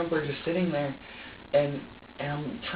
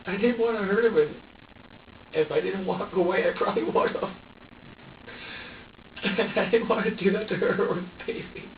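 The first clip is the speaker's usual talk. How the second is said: high, broken crying voice; tearful distress; 4 semitones higher than usual. Real, not acted. Parent defending walking away from the baby, tearful and distressed. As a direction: plead your case through tears, pitch climbing.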